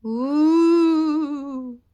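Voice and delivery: loud, high, long ghostly ooh; ghostly moan. Acted. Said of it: "You sound really scary".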